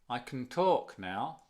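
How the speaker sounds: slow model sentence with "can" almost unheard and "talk" stressed; weak reduced can. Shown as a demonstration. Teacher repeating it for learners, barely audible "can". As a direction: let "can" shrink away and lean on "talk". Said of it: "Can, muy suavecito, casi no se nota".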